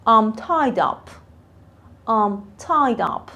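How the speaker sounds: very slow, clear I'm tied up; drawn out so learners can copy; slow model. Shown as a demonstration. Teacher modelling for students, very slow. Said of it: "teacher models phrase slowly".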